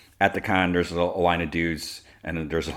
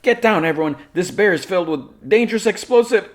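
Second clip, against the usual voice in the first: high, loud mock alarm; shouted warning; 14 semitones higher, 7 dB louder than usual. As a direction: yell a panicked warning to the crowd, hammed-up. Lois shouts a bomb warning.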